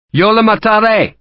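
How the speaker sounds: high, loud threat in halting Spanish; Sharp warns the townsmen in Spanish, loud and high. A narrator's reading.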